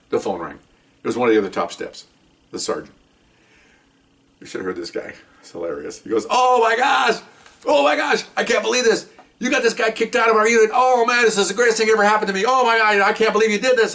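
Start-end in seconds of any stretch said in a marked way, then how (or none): 6.3-13.9 all excited. Retold or imitated. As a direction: squeal it like the best news of his life. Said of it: "He's all excited".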